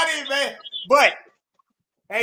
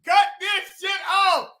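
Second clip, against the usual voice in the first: raised, high-pitched outburst; exasperated rant; 4 semitones higher than usual. Real, not acted. Panelist demanding the clip be cut off, exasperated rant. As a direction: shout it in fed-up disbelief. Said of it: "Forget the rant".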